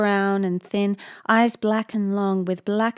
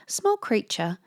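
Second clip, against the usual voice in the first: usual speaking voice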